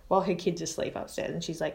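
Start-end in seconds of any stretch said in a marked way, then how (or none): none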